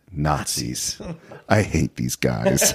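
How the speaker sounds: very low, slow imitation of a disgusted hero; weary disgust. Quoting the hero on seeing Nazis below, low weary disgust. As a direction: drop deep and drag out the mock loathing.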